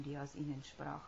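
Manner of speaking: ordinary delivery, nothing marked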